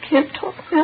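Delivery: choked up. Acted. Daughter breaking down under questioning.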